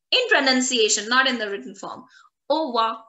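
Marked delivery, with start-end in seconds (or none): 2.5-3.0 Ova, au revoir without the R E; cut short. Shown as a demonstration. Teacher shows everyday French pronunciation, Ova for au revoir. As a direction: say Ova as two syllables, "oh-vwa". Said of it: "This is how they pronounce".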